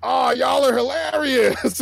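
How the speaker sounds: high, slow imitated amused drawl; amused barber. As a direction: play the barber won over, drawn out. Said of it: "and he was like".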